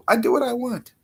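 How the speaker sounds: high-pitched and cheeky; high playful defiance. Pretend. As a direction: pipe it up high in mock defiance. Playful defiance, repeated in contrast.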